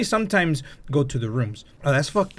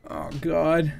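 ordinary delivery

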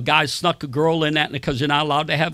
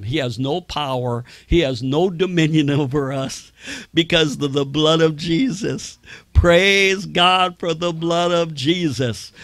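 preaching. Acted. Preaching declaration.

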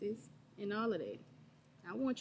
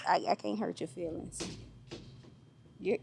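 normal talking voice

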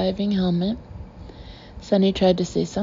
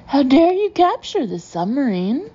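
Fiona cried; 6 semitones higher, 3 dB louder than usual. A narrator's reading. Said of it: "Fiona cried".

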